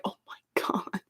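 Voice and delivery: breathless, unvoiced whispered oh my God; awed whisper. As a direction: hush it in total awe. Awe when Wakanda arrives.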